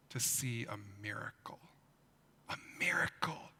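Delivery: hushed whisper of wonder; awed whisper. Acted. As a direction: whisper it like a secret. Building wonder for children.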